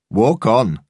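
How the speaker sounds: mock the dog owner's order; mocking echo. A narrator's reading. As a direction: throw the owner's words back at him. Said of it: "the weightlifter said".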